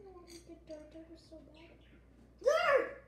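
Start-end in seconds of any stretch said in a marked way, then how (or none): 2.4-3.0 high, loud disgusted shout. Real, not acted. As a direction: cry out the flavor. Recognizes dirt flavor.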